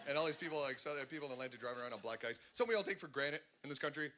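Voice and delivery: ordinary delivery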